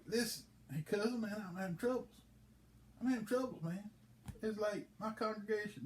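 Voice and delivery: high, complaining preacher voice; whiny preacher. Acted. Preacher whining to his cousin, pitched high.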